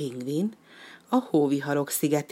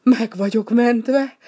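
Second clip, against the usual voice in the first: higher, much louder slow cry of relief; rescued duck crying out in relief, higher and much louder; relieved cry; 7 semitones higher, 8 dB louder, slower than usual. Acted. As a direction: burst out with relief. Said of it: "sóhajtott fel megkönnyebbülten".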